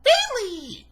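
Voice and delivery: mocking, squeaky-high ring announcement; rising high-pitched announcer. Reviewer mocking a horrible ring announcer, drawn-out high pitch. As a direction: stretch the name out way up high. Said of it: "a little pitch in her voice at the end".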